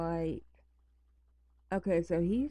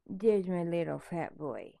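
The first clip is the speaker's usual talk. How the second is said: usual speaking voice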